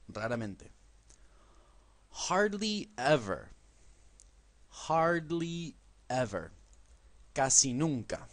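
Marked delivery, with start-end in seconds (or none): 2.1-6.5 Hardly ever slowly, stress on hardly; slow model phrase. Shown as a demonstration. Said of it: "Repitan después de mí".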